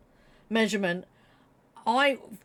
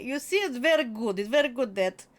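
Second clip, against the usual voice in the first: mock Russian accent; faster than usual. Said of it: "she is someone who loves communism".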